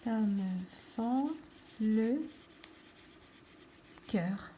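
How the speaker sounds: one word at a time, pauses between; slow, separated words for copying; dictating while writing. Shown as a demonstration. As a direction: spell it out slowly as you write. Saying words while writing them.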